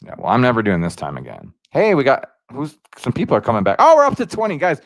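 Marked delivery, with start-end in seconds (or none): 3.8-4.8 high, loud burst of joy at the viewer count; excited. Real, not acted. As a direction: cheer it out, genuinely thrilled. Viewer count jumps on stream.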